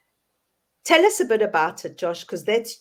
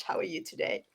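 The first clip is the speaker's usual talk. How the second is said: put-on playful South African accent, pitched up and soft; 8 semitones higher, 10 dB quieter, faster than usual. Host greeting her South African co-host in a mock South African accent. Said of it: "I'm understanding that South African accent".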